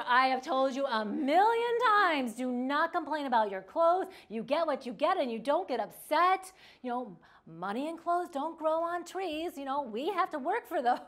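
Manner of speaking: hammed-up, forceful parental rant; lecturing parent. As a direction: act a fed-up parent laying down the law. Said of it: "You know, we lecture".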